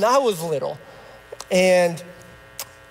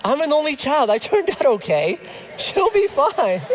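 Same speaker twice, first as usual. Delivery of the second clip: half-laughing, pitch rising higher into a giggle; laughing excuse; 5 semitones higher than usual. Retold or imitated. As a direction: joke it off through a laugh. Joking excuse to wife, laughing.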